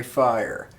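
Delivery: ordinary talk, nothing marked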